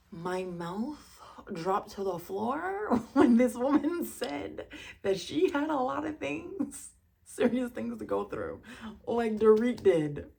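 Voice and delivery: loud, slow, emphatic disbelief; incredulous outrage. Real, not acted. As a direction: stretch it out in real astonishment. Astonished at friend's trivial complaint.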